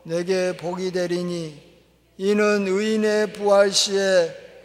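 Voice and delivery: slow, loud, weighty Bible reading; solemn scripture reading. Acted. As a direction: proclaim each phrase slowly to the congregation. Reads Luke 14 scripture aloud. (느리고 힘찬 성경 봉독)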